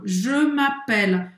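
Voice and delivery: "Je m'appelle" drawn out, rounded "je"; slow model. Shown as a demonstration. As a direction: say "Je m'appelle" slowly. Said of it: "Presta atenção aqui, o je". (pronúncia lenta de "Je m'appelle")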